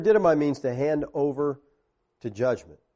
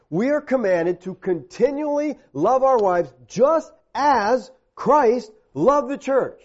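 high, slow, emphatic preaching; forceful preaching; 8 semitones higher, 5 dB louder, slower than usual. Real, not acted. Preacher urges husbands to love as Christ did, forceful. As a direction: drive each phrase home, pitched high and drawn out.

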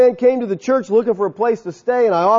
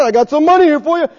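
higher, louder and quick, eager to hand it over; eager Ananias; 8 semitones higher, 5 dB louder, faster than usual. Pretend. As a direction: play Ananias pushing his bag of cash forward. Ananias voiced offering cash.

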